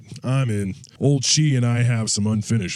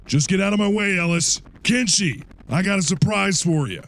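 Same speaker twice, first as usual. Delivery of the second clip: high, raised taunting shout; retold battle yell; 11 semitones higher than usual. Soldier yelling at Ellis and Kenshi before firing, a raised taunt. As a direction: taunt them as you take aim. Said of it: "I was about to blow them".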